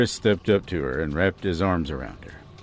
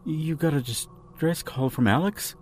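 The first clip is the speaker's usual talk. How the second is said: higher, softer, unsettled question; shivering; 6 semitones higher, 6 dB quieter than usual. A narrator's reading. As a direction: ask it in a small, high, shivering voice.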